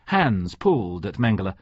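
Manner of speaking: plain reading voice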